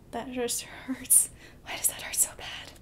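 pained whisper. Real, not acted. Viewer whispering, hurt by a sad scene.

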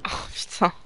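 breathed, voiceless swear; whispered curse. Real, not acted. Gamer nearly dying, whispering a stunned curse. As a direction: curse under your breath. Near death in the game.

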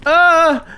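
high, loud cry of alarm; short scream at a jump scare; sudden high "ah"; startled yelp. Real, not acted. Worm attacked again.

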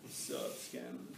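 low, husky dude voice with a breathy edge; mock tough-guy greeting. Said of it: "then they come and go".

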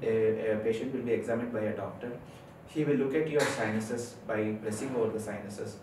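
ordinary talk, nothing marked

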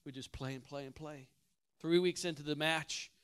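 normal talking voice

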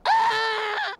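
put-on full-throated child's scream; high scream. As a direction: scream like a terrified little girl. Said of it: "began to scream".

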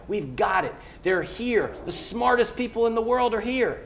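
fired up. Real, not acted. Fired up by Mike, pitching Philly's young talent with real excitement. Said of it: "you got me going, Mike".

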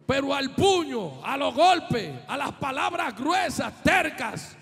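loud, rising shouted preaching; preaching at full force. Acted. Preacher to the congregation, loud and shouted. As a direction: drive it home to the congregation.

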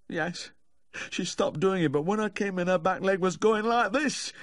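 high, plaintive whine; weepy high-pitched lament; sniffing and moaning. A narrator's reading. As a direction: moan it high and anxious. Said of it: "Humphrey, sniffing and moaning".

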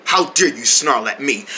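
ordinary talk

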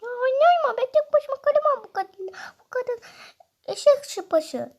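put-on high, whiny toddler begging mom; whiny baby voice. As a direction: play a spoiled little kid, pitched high. Baby character whines to mom.

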